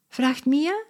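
usual speaking voice

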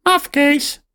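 very high squawking parrot. A narrator's reading. Parrot Polly squawks hallo, very high. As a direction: screech it like a parrot. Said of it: "zegt Polly".

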